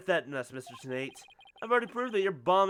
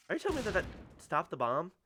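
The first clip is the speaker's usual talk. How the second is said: normal talking voice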